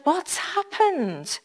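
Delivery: soft, slow, high gentle question; a hint of a concerned helper asking; lightly voiced kind lady. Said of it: "So she said".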